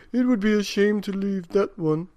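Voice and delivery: put-on an old man won over by a kitten. A narrator's reading. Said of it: "said the very old man".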